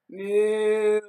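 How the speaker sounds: lowing moo; a voiced cow moo. Cow answers Duck.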